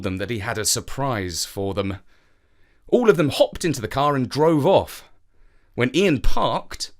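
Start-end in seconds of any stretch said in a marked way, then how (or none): none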